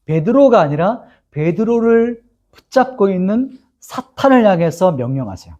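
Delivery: ordinary talk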